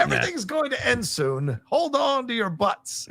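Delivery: mock fatalist Tim. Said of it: "Tim is just like".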